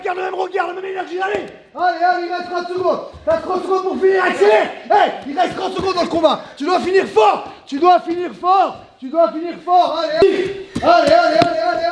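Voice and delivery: high urgent coaching shout. Real, not acted. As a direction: yell him through the last round. Coach pushes the final 30 seconds.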